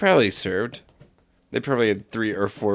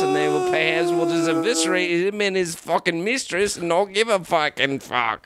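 mock Australian musing; Australian accent; 2 semitones higher than usual. Italian sizes up Mussolini, voiced in Australian accent. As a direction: muse in a put-on Australian accent. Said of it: "it's just all Australian".

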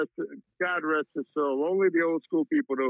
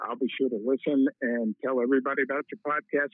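normal talking voice

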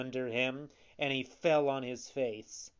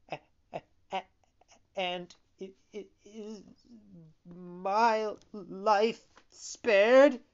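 halting, higher stutter rising to a question; fearful stammer; 7 semitones higher, 5 dB louder than usual. A narrator's reading. Poor Harlequin asking whether his life is spared, stammering fearfully.

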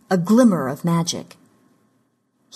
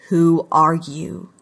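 slow, wary question; suspicious; slower than usual. A narrator's reading. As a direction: ask it slowly, eyeing an intruder with suspicion. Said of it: "his eyes narrowed with suspicion".

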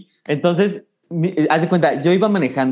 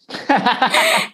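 burst of laughter; 9 semitones higher than usual. Real, not acted. Laughs at firing his mother.

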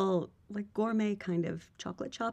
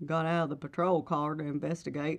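police chief voice; 3 semitones lower than usual. Acted. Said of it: "I will do it in a voice".

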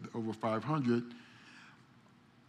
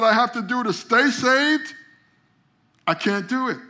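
higher, louder, driving sermon climax; full-force preaching; 9 semitones higher, 9 dB louder than usual. Acted. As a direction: preach it hard to the congregation.

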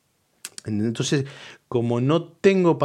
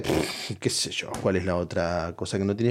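whispered shrug; 6 semitones lower, 6 dB quieter than usual. Real, not acted. Shrugging aside.

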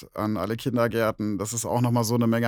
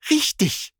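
much higher, delighted cat voice; 3 dB louder than usual. Acted. Cat praises the bear's answer, high and delighted. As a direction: squeal with pleasure like a cat. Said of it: "freute sich die Katze".

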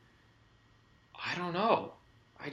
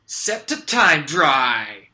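hero catchphrase; 9 dB louder, much slower than usual. Acted.

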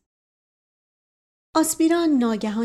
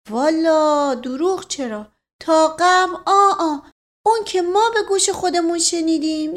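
put-on folksy old servant; 8 semitones higher, 6 dB louder than usual. Mash Qasem describing the explosion sound, rustic and rambling. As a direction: spin the tale like a village elder swearing it's true.

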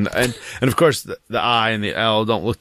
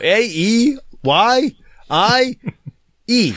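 high, loud rising guess on every letter; hopeful questioning letters; child guessing; 11 semitones higher, 4 dB louder, much slower than usual. Retold or imitated. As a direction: imitate a kid guessing vowels one by one. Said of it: "She's like".

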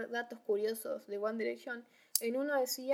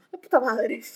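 louder cursing in alarm; loud swearing; 11 dB louder than usual. Retold or imitated. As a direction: blurt out the curse loud.

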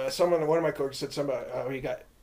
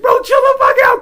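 very high, loud retold outburst; mock pleading yell; 15 dB louder than usual. Storyteller replaying himself restraining his friend, high pleading yell. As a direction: yell it high as if breaking up a fight.

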